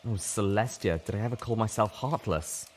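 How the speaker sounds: plain reading voice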